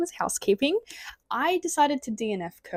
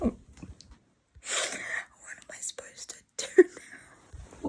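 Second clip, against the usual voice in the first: lost whisper; 10 semitones higher, 6 dB quieter, slower than usual. Real, not acted. Finished beloved series, lost.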